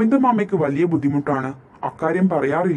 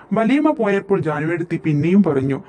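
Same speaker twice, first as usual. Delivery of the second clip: ordinary delivery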